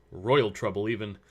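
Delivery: ordinary delivery, nothing marked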